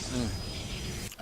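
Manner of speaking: breathy stifled yawn. Real, not acted. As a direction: swallow a yawn. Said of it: "I don't know why I'm yawning".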